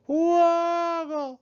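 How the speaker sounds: high, gurgled woggle; a cry half full of water. A narrator's reading. Hedgehog's cry swallowed by water, a high gurgle. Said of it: "sound that came out was more like".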